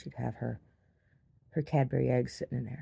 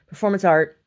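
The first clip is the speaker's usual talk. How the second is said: much louder, higher announcement; mock proclamation; 5 semitones higher, 12 dB louder than usual. Artist announcing bread baked at gallery, loudly mock-grand. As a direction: proclaim it like an artwork title.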